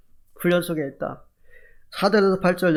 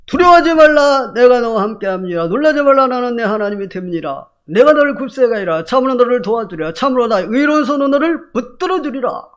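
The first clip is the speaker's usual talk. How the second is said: louder, higher, proclaiming the verse; fervent scripture reading; 5 semitones higher, 4 dB louder than usual. Acted. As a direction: declare the verse to the congregation like a promise. Preacher reads Isaiah verse aloud.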